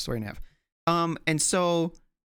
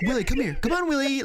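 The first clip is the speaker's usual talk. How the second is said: calling the dog; 9 semitones higher than usual. Retold or imitated. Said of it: "just yell".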